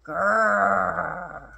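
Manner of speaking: long, rolling growl; tiger growl. A narrator's reading. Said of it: "loud and very scary noise".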